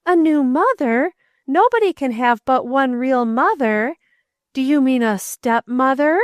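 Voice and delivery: stepmother with heavy stress on step; ominous emphasis. A narrator's reading. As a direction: lean darkly on stepmother. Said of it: "With ominous emphasis on that word".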